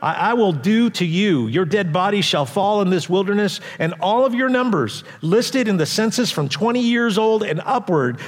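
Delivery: the Lord's warning read at full voice; scripture reading. Acted. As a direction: proclaim it from the pulpit with weight.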